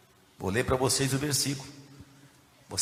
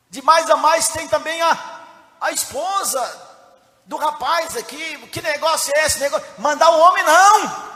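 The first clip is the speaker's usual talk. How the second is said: loud, shouted sermon delivery; impassioned, shouting preacher; full-force preaching; 14 semitones higher, 10 dB louder than usual. Real, not acted. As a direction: hurl the story at the congregation.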